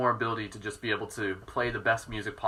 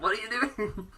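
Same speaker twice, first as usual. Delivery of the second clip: higher, laughing dog voice; voicing the dog; 10 semitones higher than usual. Owner voicing her dog's puzzled question while laughing. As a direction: speak for the confused dog. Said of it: "He's like".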